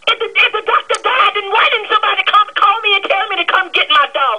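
high, indignant yelling; angry pet owner yelling down the phone. Real, not acted. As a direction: raise your pitch and demand answers. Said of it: "you gonna have to stop yelling at me".

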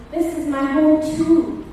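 ordinary delivery

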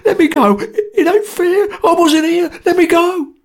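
high, loud desperate pleading; panicked struggle. A narrator's reading. Sotcher pushes at Jerry, pleading high and loud. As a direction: fight to get free, crying out.

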